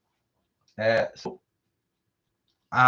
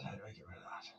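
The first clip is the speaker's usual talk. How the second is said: whisper; 4 semitones lower, 26 dB quieter, much faster than usual. Real, not acted. Muttering while fixing stream.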